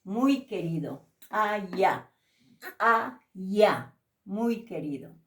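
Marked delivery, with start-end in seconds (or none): 1.3-3.9 "kaya" with a hard glottal k, falling. Shown as a demonstration. Teacher modelling glottalized k for students, crisp. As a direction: pop the k at the back of the throat in "kaya". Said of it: "que se pronuncia con K".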